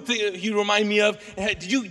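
ordinary talk

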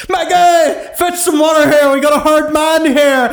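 high, loud, hammed-up Irish accent; terrible Irish accent. Host quoting the farmer in a hammed-up Irish accent. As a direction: overdo a bad movie Irish brogue. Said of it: "It's the most terrible accent".

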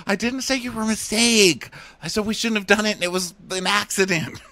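high-pitched whiny imitation of the man; playing Blake. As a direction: make excuses in a squeaky, wounded voice. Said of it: "you be Blake right now".

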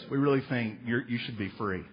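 mock Supreme Court. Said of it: "the Supreme Court goes".

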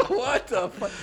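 high, slow words through laughter; laughing. Real, not acted. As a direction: laugh it out in disbelief. Laughing at the bot's answer.

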